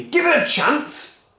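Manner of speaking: high, slow, exasperated; impatient husband. Acted. Mister Cranky pleading with wife, slow exasperated. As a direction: beg for patience.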